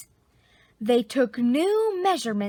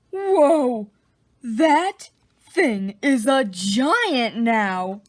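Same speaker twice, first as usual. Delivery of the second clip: amazed; 5 dB louder, much slower than usual. Real, not acted. Reader reacts to giant chick.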